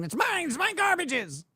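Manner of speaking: shrill-high mocking voice, high pitch; high mock whine. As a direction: whine it like a greedy goblin. Mocking the annexed cubby.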